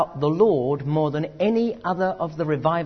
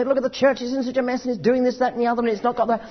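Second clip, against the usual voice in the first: mock worried churchgoer, tumbling out fast; upset church complaint; 8 semitones higher, faster than usual. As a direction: rattle off the grievances in a fluster. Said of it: "We get all upset and say".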